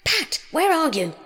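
high, loud, angry calling; angry high-pitched summons. A narrator's reading. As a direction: shout crossly for a missing servant. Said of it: "Next came an angry voice".

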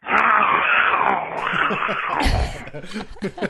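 rasping undead groan; zombie growl. Acted. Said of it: "here's my zombie zone".

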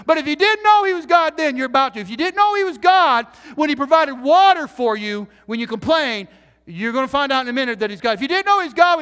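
loud, high, driving sermon cadence; full-force preaching. Acted. Pastor preaching full force to the church. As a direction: preach it hard to the congregation.

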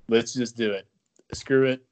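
loud, punchy call to just go for it; fired-up shout. Real, not acted. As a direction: bark it out like a dare. Hyping a reckless team plan.

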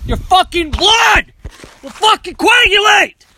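high, loud angry yell that climbs; mad shout. Acted. Diet guru loses it yelling about blood clotting, furious shout. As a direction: blow up in fake rage over the diet. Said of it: "Sorry, I get so fucking mad".

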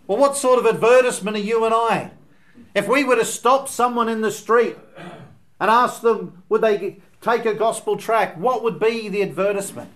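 loud, high, urgent pulpit voice; preaching at full force. Acted. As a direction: drive the question home to the congregation. Preacher challenges congregation loudly.

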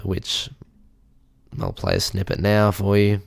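ordinary talk, nothing marked

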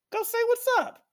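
squeaky, pushy nudge; friends urging. Retold or imitated. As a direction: friends at the bar pushing him to go over, high mock voice. Drunk friends urging him.

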